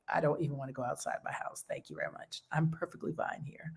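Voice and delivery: hushed, breathy mock whisper of her hiding self. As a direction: whisper it like you never want to leave the house. Mocking her withdrawn past self.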